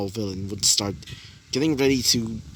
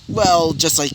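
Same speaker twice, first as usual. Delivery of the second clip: plain reading voice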